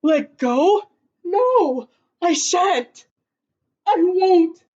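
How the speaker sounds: loud, stubborn shouts; Kevin, scared in the tree, shouts no to the crowd; shouted refusal. Acted. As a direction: refuse at the top of your voice. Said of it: "shouted Kevin".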